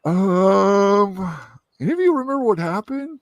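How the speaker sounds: long, loud high 'um', then a quick question; stall, then ask all innocently; sheepish feigned innocence. Acted. Deadpool to the friends he drugged, feigned innocence.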